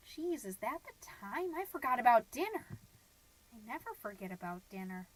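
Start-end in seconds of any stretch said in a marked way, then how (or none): 3.5-5.1 bleak brooding. Acted. Mom dwelling on husband's dinner of cocktail weenies, bleak brooding. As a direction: sink into a soft, monotone dark reverie.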